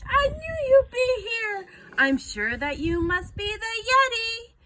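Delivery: high, loud and thrilled; excited boy. A narrator's reading. George greeting the monster he takes for the Yeti, excited. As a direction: burst out with eager joy.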